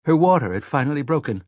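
ordinary delivery, nothing marked

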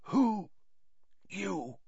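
fading broken question; whispered. Acted. Crouch asks Harry, fading. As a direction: barely voice it. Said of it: "he whispered".

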